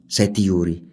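usual speaking voice

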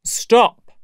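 high, loud command; cried out. Acted. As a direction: cut them off with a shout. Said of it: "he cried".

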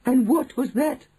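high, loud eager question; crying out. A narrator's reading. Witch audience asking their leader, high cry. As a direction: ask it high, all agog. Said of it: "cried the audience".

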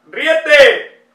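loud, high snapping command; angry shout. Acted. Arguing with himself over the phrase, shouting the order. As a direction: bark the order at yourself.

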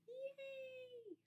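high, soft, drawn-out cheer; excited cheer. Shown as a demonstration. Teacher showing her class excited, high little cheer. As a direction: cheer high as if thrilled, without shouting.

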